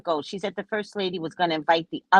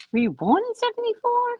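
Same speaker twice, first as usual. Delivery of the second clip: high incredulous question; incredulous tease. Real, not acted. Teasing a young admirer.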